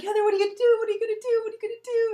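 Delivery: a frantic friend chasing after her; panicked. Retold or imitated. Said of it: "He's like".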